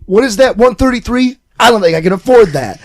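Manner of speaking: hammed-up shopper balking at the total; imitating twitchy customer. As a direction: push the pitch and volume up for her quote. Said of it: "But she's like".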